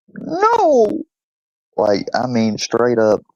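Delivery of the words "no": high-pitched howled word, dog-style; talking dog. Retold or imitated. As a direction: howl it like a dog trying to speak. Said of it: "and the dog went".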